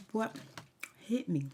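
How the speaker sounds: usual speaking voice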